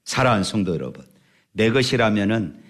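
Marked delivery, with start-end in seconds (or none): none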